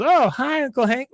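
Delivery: high-pitched mock girl greeting; imitated niece's high hello; chirpy niece. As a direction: greet your uncle high and oblivious. Niece greets uncle mid-protest.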